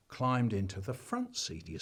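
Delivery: ordinary delivery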